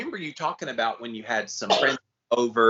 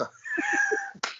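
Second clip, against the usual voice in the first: high giggle; 4 dB quieter than usual. Real, not acted. Laughing after quoting song.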